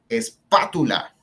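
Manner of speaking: espátula slowly, with weight on PÁ; es-PÁ-tula, emphatic and slow; stress on antepenultimate syllable. Shown as a demonstration. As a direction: lean on the second syllable of espátula. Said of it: "que es en la antepenúltima sílaba".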